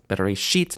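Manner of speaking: BERESHIT stressed on the last syllable, SHIT; final stress. Shown as a demonstration. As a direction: land the weight on the SHIT of Bereshit. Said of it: "end, final stress".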